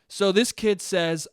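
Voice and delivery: ordinary talk, nothing marked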